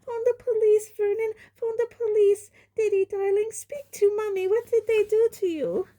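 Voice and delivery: very high, loud panic; mother screaming to husband for police. A narrator's reading. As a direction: shriek for the police. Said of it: "Aunt Petunia screamed".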